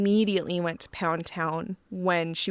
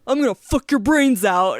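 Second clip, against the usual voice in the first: louder hammed-up hero line; mock hero line; 8 dB louder than usual. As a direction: blurt it like a crude hero. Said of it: "and then he just goes".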